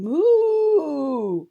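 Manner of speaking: a huge moo, high and loud. Acted. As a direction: belt out the moo as loud as you can. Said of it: "just as loudly as possible".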